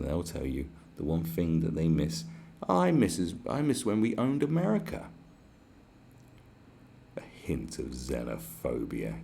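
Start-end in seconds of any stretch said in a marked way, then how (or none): 2.7-5.1 lightly voiced, higher old person reminiscing; a touch of a wistful old Brit. Mocking old people who miss owning America, light wistful voice. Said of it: "Meet any old person". 7.2-9.2 slow, scornful whisper; sneering whisper. Real, not acted. Mocking the commenter's phrase in a scornful whisper.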